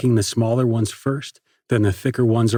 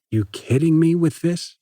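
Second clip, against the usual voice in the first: frustrated; 6 semitones higher than usual. Acted. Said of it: "his face knotting up with rage".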